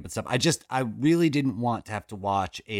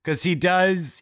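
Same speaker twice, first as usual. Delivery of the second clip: ordinary delivery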